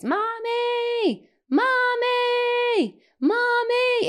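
put-on high, drawn-out child's yell for mom; screaming child. Eli calls for mom on waking, screaming child. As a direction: imitate a small boy screaming for his mother. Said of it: "he's just screaming my name".

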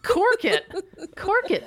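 mock bossy command. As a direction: shush someone in a squeaky voice.

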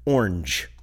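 orange clipped to one syllable. Shown as a demonstration. Brother testing one-syllable orange on his award. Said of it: "weird to say in one syllable".

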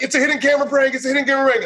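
high, hurried shout; frantic calling out. Retold or imitated. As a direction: shout it fast to stop the guy. Calling out to the barber.

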